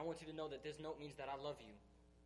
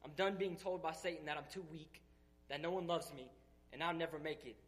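building, louder fervent preaching; full-force preaching; 4 dB louder than usual. Acted. Speaker to his church, declaring he is done with fear, in building preaching. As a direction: pour it out like a revival sermon, climbing.

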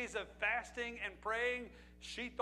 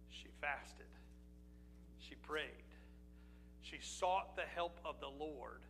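soft, lower, almost breathed; hushed solemn; 5 semitones lower, 7 dB quieter than usual. Acted. Preacher telling the congregation what Esther did, hushed and solemn. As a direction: drop the voice to a reverent hush. Solemn turn in sermon answer.

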